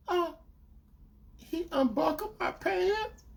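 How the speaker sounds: high, slow rising mimicry; hesitant girlfriend. Girlfriend confessing the next step, imitated hesitantly. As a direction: let it out slowly in a high voice.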